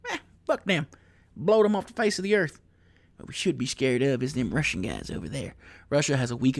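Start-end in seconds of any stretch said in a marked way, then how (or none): 0.1-2.6 high-pitched mock Texan; Texan local. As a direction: scoff like a caricature Texan. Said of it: "you ask anybody here in Texas".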